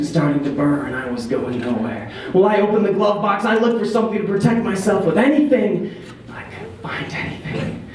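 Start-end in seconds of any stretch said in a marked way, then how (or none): 6.3-7.9 breathless whisper. A narrator's reading. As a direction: whisper it in panic. Panicked search, voice drops.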